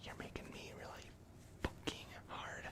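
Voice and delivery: hushed, whispered threat to a captive; whispered grievance to a prisoner; menacing whisper. Acted.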